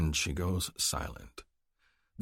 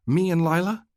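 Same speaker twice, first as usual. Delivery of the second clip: put-on young woman's voice; 11 semitones higher, 6 dB louder than usual. A narrator's reading. Female character, sister.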